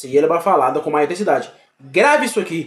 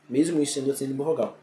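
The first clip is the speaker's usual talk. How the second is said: "Mesmo o I sendo uma semivogal" repeated fast and much softer, almost an aside; quick quiet repeat; 3 semitones lower, 8 dB quieter, much faster than usual. Shown as a demonstration. Teacher murmuring the rule again to herself, quiet and fast. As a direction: toss the rule off again under the breath.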